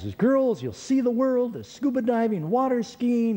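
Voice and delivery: high eager sales pitch voice; selling it. Retold or imitated. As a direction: pitch it like an enticing deal. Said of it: "And he says".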